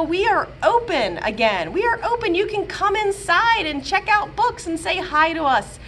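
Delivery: ordinary talk, nothing marked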